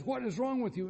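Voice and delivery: high-pitched mock alarm, voice leaping up; alarmed exasperation. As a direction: act out a startled boyfriend demanding an answer. Boyfriend reacts to her screaming.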